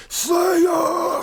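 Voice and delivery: excited band-name shout. Real, not acted.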